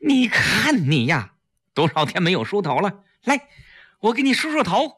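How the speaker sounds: mild mock scolding; staged loud fussing. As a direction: cover the secret with loud fuss. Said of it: "大声说".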